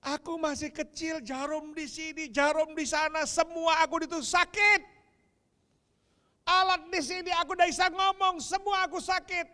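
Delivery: put-on a sick child crying out in pain. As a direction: plead like a small sick child. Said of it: "Anakmu tuh teriak".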